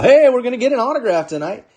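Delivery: high, fast hype announcement; excited hype. Real, not acted. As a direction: build up the big hit. Announcing an autograph pull.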